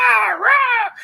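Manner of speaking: high, loud, strangled bird cry; dying hawk screech. Retold or imitated. Mocking Sean Connery's accent as a murdered hawk screech. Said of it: "murdering hawks in the background".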